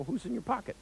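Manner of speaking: high rising question on "Who's in your pocket?"; insistent repeat question. Retold or imitated. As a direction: repeat it high-pitched, voice lifting at the end. Asks again after their "What?.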